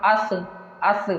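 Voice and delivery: अस with the Arabic sa sound; Arabic articulation. Shown as a demonstration. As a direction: say अस from the proper Arabic makhraj. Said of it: "इसको अरबी में क्या कहते हैं". (अस को अरबी मखरज के साथ)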